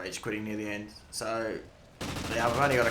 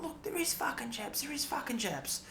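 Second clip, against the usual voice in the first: put-on much higher, frantic kid's gloating cry; excited jumping up and down; 4 dB quieter than usual. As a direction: crow it like a kid proving the teacher wrong. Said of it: "David jumps up and down going".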